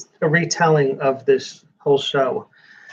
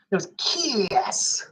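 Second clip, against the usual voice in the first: hammed-up announcer; 4 semitones higher, 6 dB quieter, much slower than usual. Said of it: "You can't say it like that".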